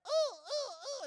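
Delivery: very high, loud dolphin ooh; dolphin squeak. Acted. Comic mimicking the dolphin before its kiss, high loud squeal. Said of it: "he's like".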